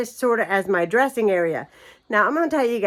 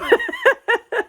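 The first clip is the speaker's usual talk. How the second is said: high amused laughter; laughing. Real, not acted. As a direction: laugh at your own mess. Laughs about the laundry.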